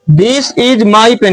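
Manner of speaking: each word read out very slowly and clearly; slow dictation. Shown as a demonstration. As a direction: read it slowly so the class can check it. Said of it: "ये sentence सही है या गलत है". (बहुत धीरे, साफ़-साफ़ बोलकर)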